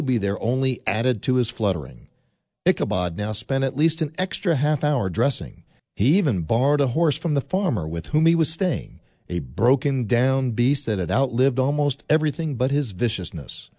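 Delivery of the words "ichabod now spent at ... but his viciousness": ordinary talk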